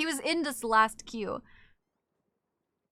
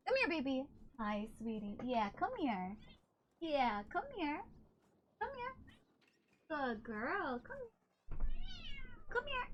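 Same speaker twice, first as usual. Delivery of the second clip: soft high coo calling the cat, much softer; coaxing pet voice; 4 semitones higher, 11 dB quieter, slower than usual. Real, not acted. Coaxing her cat Rika to come over, soft and cooing. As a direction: sweet-talk the cat over to you.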